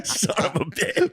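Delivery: high, slow curse broken by laughter; laughing mock curse. Retelling the frustrated gunman at the tree, laughing. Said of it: "And he's like".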